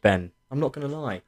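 usual speaking voice

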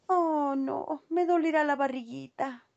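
ratita pensativa en voz aguda; lamentándose con voz de ratón; voz de ratita, más aguda. A narrator's reading. La ratita duda qué comprar. (ratita que se preocupa, con voz aguda)

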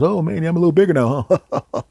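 high, loud, laughing spider voice; giant spider character. Acted. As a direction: play a chuckling, chummy overgrown spider. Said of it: "He goes".

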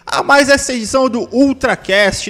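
upbeat radio announcer opening; podcast host intro. Acted. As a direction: welcome the listeners like a show host.

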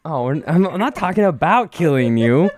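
loud, high, shooing her out; shouting at wife. Real, not acted. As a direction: yell at the intruder to leave. Shooing wife who barged in.

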